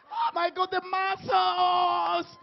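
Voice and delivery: high squeal, last word stretched long; mock swooning fan. Host mimicking ladies admiring the DJ, mock swooning fan.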